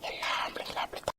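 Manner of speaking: breathy hushed whisper, barely audible. Acted. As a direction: lean in and whisper it like a secret. Wife teasing husband aside.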